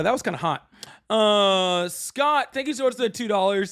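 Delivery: ordinary talk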